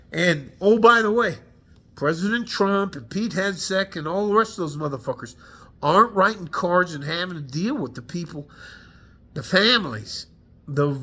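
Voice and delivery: angry rant. Real, not acted. Veteran daring the President onto the front lines, angry. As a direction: call out the leaders, furious. Veteran ranting at war leaders.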